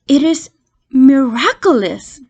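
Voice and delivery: ordinary delivery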